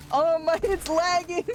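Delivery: laughing dismay. Real, not acted. Streamer sees the flares lag the game, laughing dismay. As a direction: cry out through laughter.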